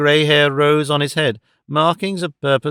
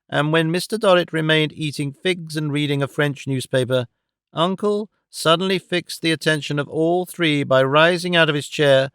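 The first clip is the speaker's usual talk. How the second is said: ordinary delivery, nothing marked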